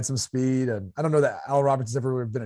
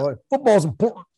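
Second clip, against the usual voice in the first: football's important with the last word cut short; football's important clipped before its final sound; chopped ending; 5 semitones higher, 4 dB louder than usual. Shown as a demonstration. Showing how coaches chop the end of words, last word cut off. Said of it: "You just gotta chop it off".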